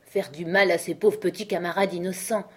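ordinary delivery, nothing marked

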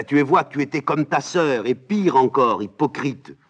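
indignant nurse scolding a princess; nurse bursts out. Acted. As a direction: let the old nurse explode at the girl. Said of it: "La nourrice éclate".

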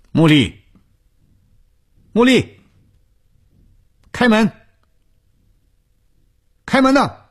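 high, loud shouts rising in pitch; calling out. A narrator's reading. Mistress demanding the door be opened, high loud calling. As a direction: shout insistently through a shut door. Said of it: "舒子英再次的叫着".

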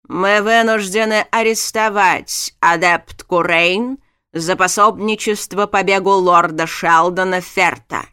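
slow, loud, each word struck; clipped words. A narrator's reading. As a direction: rap out every word. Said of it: "чеканя слова".

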